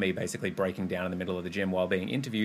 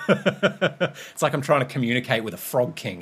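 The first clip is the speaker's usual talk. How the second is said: laughing; 4 semitones higher, 5 dB louder than usual. Real, not acted. Laughs at her own choking demo.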